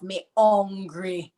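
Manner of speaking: slow, "Me hungry." with hungry said as "ungry", no H; patois me hungry. Shown as a demonstration. Auntie [name] showing the kids how to say it. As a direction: drop the H: me ungry, slowly. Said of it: "you can take the H off".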